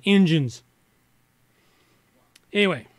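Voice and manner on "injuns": "Indians" said as IN-juns, louder; colloquial pronunciation. Shown as a demonstration. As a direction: punch out "Indians" with a j sound, IN-juns. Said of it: "So we'll go Indians instead".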